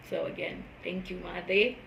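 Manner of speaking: normal talking voice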